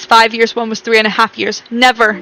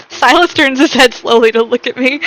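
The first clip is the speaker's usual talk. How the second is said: normal talking voice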